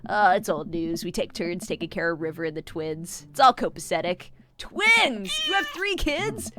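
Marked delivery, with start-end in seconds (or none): 4.6-6.5 shocked, much higher; surprised. Acted. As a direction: gasp out the disbelief. Shocked at Craig's news.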